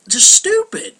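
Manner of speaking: fed-up shout of just stupid; exasperated outburst. Real, not acted. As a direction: blurt it out at the top of the voice. Exasperated rant about players.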